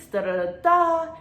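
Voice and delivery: high, loud mock babble "da, da, da"; rattling on. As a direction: rattle it off like she never stops. Rattling on in the interview.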